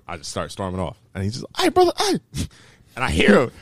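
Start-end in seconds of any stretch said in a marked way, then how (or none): 1.6-2.2 high-pitched imitation of a stranger calling; mock persistent caller. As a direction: hail someone from across the way, pitched high. Imitates stranger calling after him.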